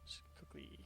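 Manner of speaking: barely voiced whisper; whispered aside. Real, not acted. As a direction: whisper it to yourself while busy. Aside while busy checking.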